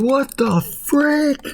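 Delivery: very high, slow horrified gasp; stunned groceries. Retold or imitated. The groceries see Potato boiled, very high horrified gasp. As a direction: drag out the disbelief. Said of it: "they're just like".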